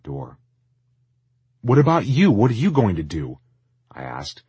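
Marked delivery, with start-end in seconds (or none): 1.6-3.4 loud, high, urgent question. Acted. Tristan calls after the owner leaving the shop, loud and urgent. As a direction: call out with sudden concern.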